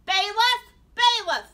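booming high-powered summons; thundering judge. A narrator's reading. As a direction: bellow for the officer. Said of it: "thundered the judge".

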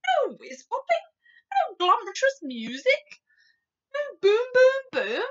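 high, loud string of questions; dismayed cry. A narrator's reading. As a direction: cry out in mounting disbelief. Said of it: "cried the B F G".

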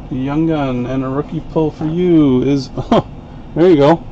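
ordinary talk, nothing marked

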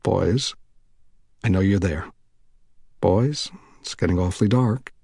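lightly voiced friendly warning. A narrator's reading. Man warning the boys in the dark, friendly voice. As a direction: warn them kindly. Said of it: "he warned in a friendly voice".

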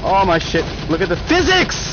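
excited outburst jumping high on the last word; wowed, high-pitched exclamation; amazed excitement. Real, not acted. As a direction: marvel loudly, pitch shooting up. Amazed at game physics.

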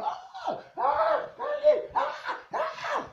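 very high, thin mock screams; mock screaming. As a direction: imitate worshippers screaming in short high bursts. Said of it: "hollering and screaming".

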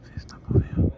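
whispered aside; whispering while hiding. Real, not acted. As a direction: hush it, the sleeper is close. Recording the snoring quietly.

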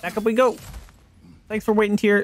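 bright high call to a friend; cheerful call. Real, not acted. Player calling to companion.

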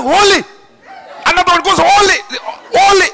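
high, loud holy; excited shout. Acted. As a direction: cry it out in awe.

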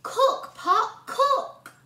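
high, loud, gleeful shout; shouting the magic words. A narrator's reading. Boy shouting the spell at the stolen pot, gleeful. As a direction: yell the spell with glee. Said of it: "couldn't wait to shout the magic words".

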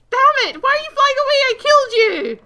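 high, very loud indignant screech; outraged yelling. Real, not acted. Gamer as the killed dragon flies off, outraged yelling. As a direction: yell after the one getting away.

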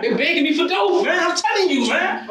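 high and loud, voice straining upward; furious yelling. Real, not acted. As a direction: yell it in outrage at the accusation. Suspect yelling at detective.

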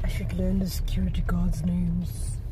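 hushed aside to the camera; whispered aside. Real, not acted. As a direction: whisper it so nobody hears. Aside muttered to self.

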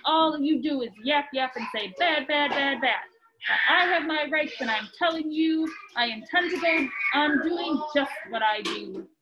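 lively storybook reading voice; reading to children. A narrator's reading. Parent reading Dr. Seuss aloud to a child, lively storybook voice. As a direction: read it out like a bedtime book to kids.

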